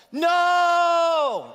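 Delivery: sudden high, loud shout; all caps no. Acted. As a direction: belt it out like capital letters. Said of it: "Oh, all caps".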